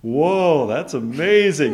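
gushing fake amazement, slowed down; awestruck crowd. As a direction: stretch it out as feigned public awe. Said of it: "everybody's like".

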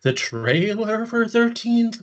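high, slow ghostly wail. Pretend. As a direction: drag every word like a ghost. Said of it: "my ghost impression".

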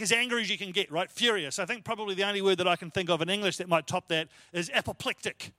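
usual speaking voice

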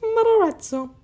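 "Matarazzo" rolled in a high, exaggerated Italian accent; high-pitched Italian "Matarazzo", laughing; mock Italian accent. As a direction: squeak "Matarazzo" like a cartoon Italian. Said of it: "your Italian accent is so high-pitched".

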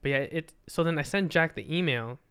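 ordinary talk, nothing marked

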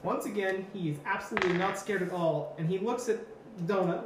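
normal talking voice

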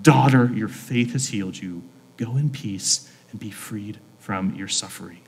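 quiet, kindly release; Jesus' tender blessing. Acted. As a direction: bless them gently, letting the voice settle down. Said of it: "Jesus says to her".

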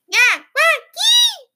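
put-on very high, loud toddler squeal; gleeful shout. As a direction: squeal like a happy little kid. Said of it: "She shouted gleefully".

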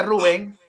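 "Rubén" stressed on the final syllable; correct Spanish Rubén. Shown as a demonstration. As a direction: say "Rubén" as roo-BEN, high and insistent. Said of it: "Pronúncielo bien".

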